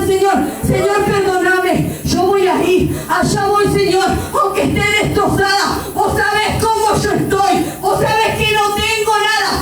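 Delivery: high, fervent cry to God; retold tearful prayer. Woman retelling her vow to obey God, fervent pleading. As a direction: pour out a driving, high-pitched plea. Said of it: "Comencé a llorar y a decirle".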